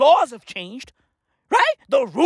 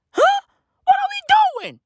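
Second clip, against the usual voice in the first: very high, incredulous yelp; exasperated squawk; 4 dB louder than usual. Acted. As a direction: screech the question in comic disbelief. Repeats the question, exasperated.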